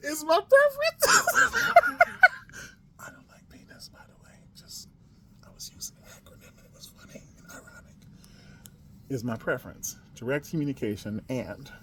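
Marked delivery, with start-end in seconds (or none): 0.1-1.3 high squeaky mock-posh voice. 1.3-2.7 shrill high-pitched giggle; high squeal laugh. Real, not acted. 3.0-7.9 hushed unvoiced whisper; whispered aside. Real, not acted. Whispered aside about the acronym.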